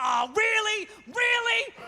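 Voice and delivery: exasperated shout at a crashed computer; mock exasperated. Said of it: "would crash and be like".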